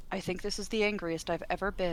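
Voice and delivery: flat, soft and calm, no anger at all; totally neutral tone. Retold or imitated. Husband calmly reporting his own anger. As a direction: say it like a mild observation. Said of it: "Totally neutral tone".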